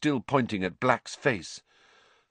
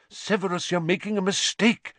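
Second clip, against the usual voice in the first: higher-pitched urgent plea; 9 semitones higher than usual. A narrator's reading. Lupin warns Severus he is mistaken, urgent. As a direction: plead urgently to be believed. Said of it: "said Lupin urgently".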